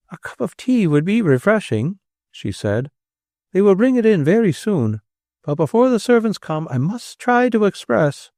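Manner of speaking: put-on gentle, proper elderly lady, lightly done; steadying herself with politeness; lightly voiced old lady. A narrator's reading. Elderly lady recovering over tea, lightly voiced. Miss Alicia's voiced lines.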